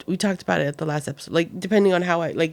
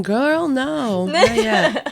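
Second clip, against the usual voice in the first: higher, playful put-on refusal; mock exasperated; 6 semitones higher, much slower than usual. Mom answers her excited daughter, mock exasperation. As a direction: act out putting off an overeager kid.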